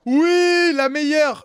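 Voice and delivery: très aigu et très fort; accueille-la comme une star; acclamation ravie. Real, not acted. Arrivée de la spécialiste. (le vlogueur acclame la spécialiste, très aigu et fort)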